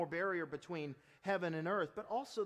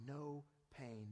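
normal talking voice

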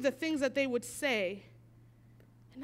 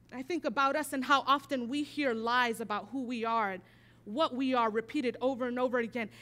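ordinary talk

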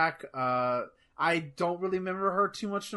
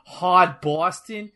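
Boston accent; 2 semitones higher, 6 dB louder, much slower than usual. Shown as a demonstration. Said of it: "she talks like she's from".

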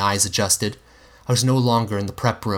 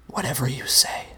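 a soft, breathy whisper, higher; dismissive whisper; 4 semitones higher, 3 dB quieter than usual. A narrator's reading. The Monster dryly dismissing the narrator. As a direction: shrug it off in a hush. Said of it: "Mister Monster replied".

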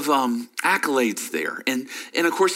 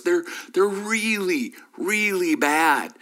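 drawn-out emphasis; 6 semitones higher, slower than usual. Real, not acted. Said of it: "slowed emphatic delivery".

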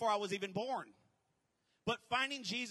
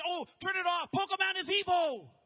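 higher, louder fussy warning; mocking his former religious self, higher and louder; mock pious scold; 7 semitones higher, 8 dB louder than usual. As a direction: play your old preachy self. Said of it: "I used to be so religious".

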